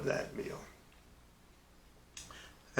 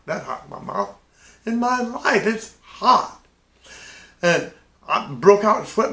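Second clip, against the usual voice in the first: mock panic; 6 semitones higher, 3 dB louder than usual. Burning mouth from hot peppers.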